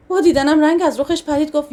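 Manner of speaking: usual speaking voice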